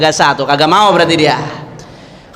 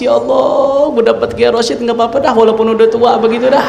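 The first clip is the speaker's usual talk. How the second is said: higher-pitched, lightly acted weeping bride; mock tearful acceptance; 6 semitones higher than usual. Bride accepting Kiai Rosyid through tears, mock tearful. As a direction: imitate a girl moved to tears, voice raised in pitch. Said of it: "diamnya sambil nangis, sambil keluar air mata".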